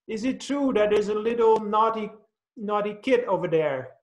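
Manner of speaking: put-on high boy's voice asking his dad; a little kid tattling to his father; lightly voiced child. Boy asks father, childlike voice.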